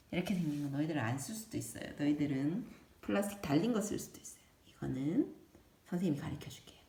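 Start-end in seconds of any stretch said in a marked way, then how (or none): none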